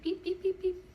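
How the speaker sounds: high, mechanical beep beep beeps; robot beeps. Retold or imitated. Robot answering the man in beeps. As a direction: chirp it like a little robot. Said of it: "He's like".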